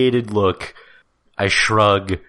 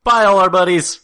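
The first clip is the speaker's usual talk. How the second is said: catchphrase shout; 10 semitones higher, 6 dB louder, faster than usual. Acted. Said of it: "and catchphrase".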